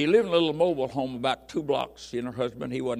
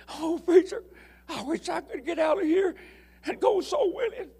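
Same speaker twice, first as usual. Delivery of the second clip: put-on high, tearful old woman's plea; crying old lady; 3 dB louder than usual. Martha in the wheelchair pleading to the preacher, high and tearful. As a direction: cry it out like a frail old lady. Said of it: "she started crying".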